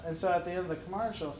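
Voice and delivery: ordinary talk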